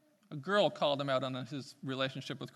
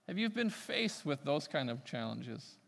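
ordinary talk

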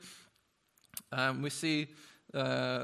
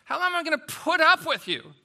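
higher and louder, fed-up cry; exasperated loud rebuke; 12 semitones higher, 10 dB louder, faster than usual. Acted. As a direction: let the frustration burst out. Jesus quote repeated with force.

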